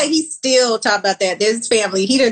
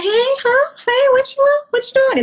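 much higher, sugary mom voice; mom's sweet company voice. Retold or imitated. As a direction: switch to mom's high polite voice. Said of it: "She be like".

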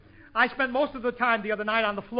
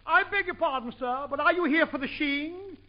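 swanky hotel clerk, higher-pitched; monocle-in-throat clerk; 4 semitones higher than usual. Acted. Swanky clerk asking a guest about skiing, affected monocled voice. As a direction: put on an affected upper-crust clerk, saying "sheeing". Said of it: "The clerk spoke with a monocle in his throat".

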